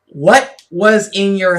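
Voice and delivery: stern cop question. Retold or imitated. Retelling the cop's pointed question.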